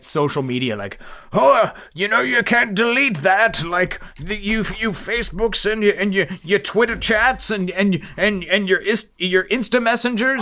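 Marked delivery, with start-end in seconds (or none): 1.3-10.4 put-on cranky old man shaking his fist; high, loud old-timer rant; grumpy old man. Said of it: "this kind of reads like an old man, uh, like, shaking his fist".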